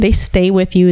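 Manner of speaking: ordinary talk, nothing marked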